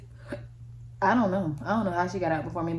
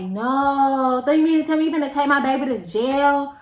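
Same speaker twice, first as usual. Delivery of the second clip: a raised, higher, indignant mother; mom's outrage; 6 semitones higher, 5 dB louder than usual. Retold or imitated. Mom confronting the officer, loudly indignant. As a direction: demand it like a mother defending her kid. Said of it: "my mom was like".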